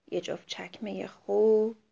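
soft whispered «چکمهٔ خوب»; a sorrowful whisper; sad whisper. Acted. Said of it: "با ناراحتی گفت".

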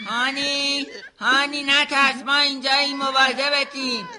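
put-on loud, slow reassurance to a crying baby behind a door; calling reassuringly. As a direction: reach the baby through the door, soothing but loud. Comforting crying baby through door.

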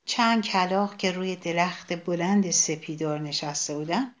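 usual speaking voice

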